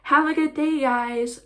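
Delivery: hammed-up fake-friendly goodbye; fake polite lady. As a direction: imitate a sarcastic "Have a good day". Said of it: "Pretend I'm the lady".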